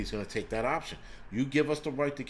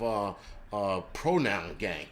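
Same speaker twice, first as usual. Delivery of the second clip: ordinary talk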